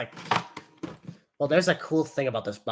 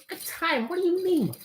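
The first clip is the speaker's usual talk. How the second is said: high, incredulous toy voice; a high little voice demanding answers; squeaky puppet voice; 13 semitones higher, 4 dB quieter than usual. Acted. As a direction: play the toy, shocked and squeaky. Toy and owner bickering.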